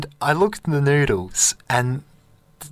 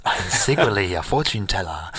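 lower, hushed mystic confession; fortune teller; 6 semitones lower than usual. Retold or imitated. Stranger revealing himself as a fortune teller, low and hushed. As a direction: lower the voice like a secret. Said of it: "he goes".

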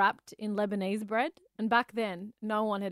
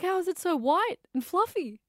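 put-on childish wonder; 9 semitones higher, 3 dB louder than usual. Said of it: "I was like".

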